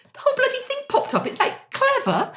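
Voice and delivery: high, laughing surprise at the bonnet popping; excited. Real, not acted. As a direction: squeal it out in delighted amazement. Bonnet pops up.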